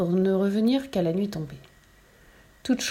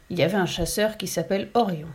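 plain reading voice

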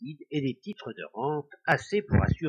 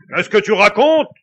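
loud, higher angry demand; angry ghost; 8 semitones higher, 16 dB louder than usual. A narrator's reading. Ghost rejecting the news of his death, loud angry demand. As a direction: fling the question back in fury.

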